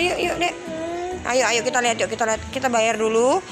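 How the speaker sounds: ordinary talk, nothing marked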